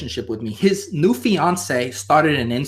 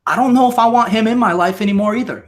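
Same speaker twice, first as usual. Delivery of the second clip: higher, louder and faster, hammed-up hurt; mock heartbroken outburst; 7 semitones higher, 4 dB louder, faster than usual. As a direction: blurt it like a jilted lover.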